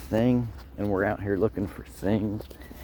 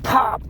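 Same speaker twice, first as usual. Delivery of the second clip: sharp high pop imitation; popping sound; 13 semitones higher, 5 dB louder than usual. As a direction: mimic a spark popping. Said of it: "and it was like".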